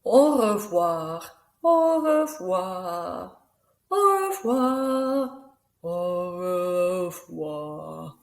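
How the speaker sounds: very slow, drawn-out goodbye; a demonstrated sad farewell, stretched right out. Shown as a demonstration. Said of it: "happy and sad".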